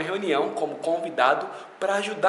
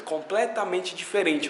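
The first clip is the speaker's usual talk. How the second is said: ordinary talk, nothing marked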